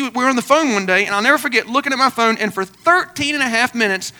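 ordinary delivery

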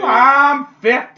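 loud, high, drawn-out comic boast; Sally O'Malley. Acted. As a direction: holler it slowly like a sketch character. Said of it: "The Sally O'Malley fifty".

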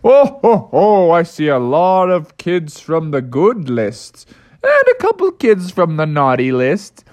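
a hint of Santa's jolly ho ho; light, jolly Santa voice; lightly voiced jolly Santa. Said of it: "he was like".

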